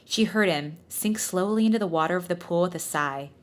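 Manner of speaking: ordinary talk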